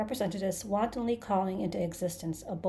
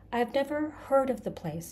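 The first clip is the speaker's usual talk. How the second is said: higher-pitched protest; Arthur, insistent; 6 semitones higher than usual. A narrator's reading. Arthur denies knowing the place, insistent. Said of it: "insisted Arthur".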